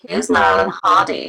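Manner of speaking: loud mock teenager voice; clueless teenager. Teenagers watching her vlog, loud mock bafflement. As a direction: blurt it out as a baffled teen, hammed up. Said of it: "all the teenagers watching this are like".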